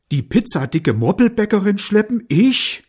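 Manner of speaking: high, loud, indignant mare; offended whinny. A narrator's reading. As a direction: exclaim in affronted disbelief as a horse. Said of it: "wieherte die Stute beleidigt". (laut und hoch, beleidigte Stute)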